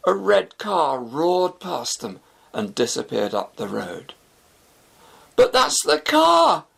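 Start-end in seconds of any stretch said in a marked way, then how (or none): 5.4-6.6 high, slow, loud outburst; shocked shout. A narrator's reading. Shafi seeing his stolen car race by, shocked shout. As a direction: yell in disbelief as it roars past. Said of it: "Shafi exclaimed".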